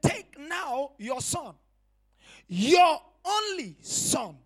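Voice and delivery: slow, building to a loud proclamation; preaching at full force. Acted. Preacher booming God's command to Abraham. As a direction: land your only son like a verdict.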